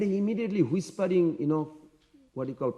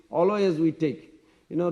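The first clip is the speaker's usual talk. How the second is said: plain reading voice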